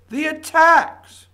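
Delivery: bursting with indignation at the attacks; outraged outburst. Real, not acted. Radio host erupting at listeners over the attacks, outraged shout.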